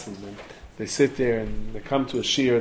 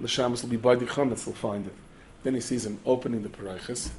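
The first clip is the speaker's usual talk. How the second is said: ordinary delivery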